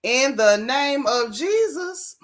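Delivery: slow, stretched words with a rising finish; incredulous slow-motion quoting; drawn-out mock quote. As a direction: repeat it slowly, dragging out every word. Repeats quote slowly in disbelief.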